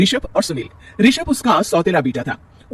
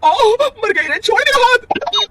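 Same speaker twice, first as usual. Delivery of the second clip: pained cry; 5 dB louder, much slower than usual. Acted. Arm twisted in fight.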